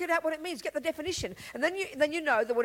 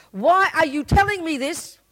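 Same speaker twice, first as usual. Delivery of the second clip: loud, higher, drawn-out challenge; mock demanding question; 5 semitones higher, 9 dB louder, slower than usual. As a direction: challenge the teacher slowly and emphatically. Voices listener challenging Buddha.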